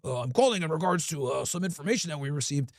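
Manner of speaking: low, soft, fake official voice; a prank caller playing a stiff government agent; mock official caller. Prankster on the phone posing as an FDA official, low and soft.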